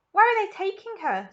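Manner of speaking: high frantic shriek; a panicked mother shrieking. Acted. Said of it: "shrieked Missus Salt".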